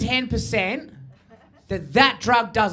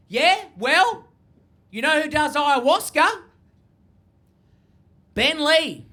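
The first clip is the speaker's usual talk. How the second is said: high, indignant challenge of an uncle; mock angry; 6 semitones higher than usual. Uncle pushing ayahuasca on his nephew, mock angry. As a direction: throw it down like a pitched-up dare. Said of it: "he got all angry".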